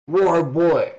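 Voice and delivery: short loud shout. Acted. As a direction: call it out hard. Character yells at beast.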